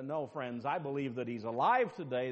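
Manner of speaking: usual speaking voice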